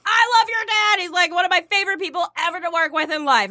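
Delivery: high, rushed retold gushing. Retelling how she praised his dad to Orrin, gushing.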